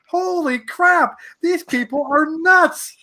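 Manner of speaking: retold shock at the crazy fans; stunned disbelief. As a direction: say it like you can't believe this crowd. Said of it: "I'm like".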